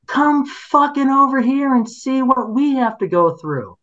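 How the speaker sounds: high, shrill-pitched demanding yell; imitated angry neighbor. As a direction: shout it at passers-by like an outraged resident. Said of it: "the other thing they said is".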